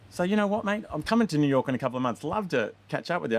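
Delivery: hyped-up filmmaker. Retold or imitated. Filmmaker promises to visit.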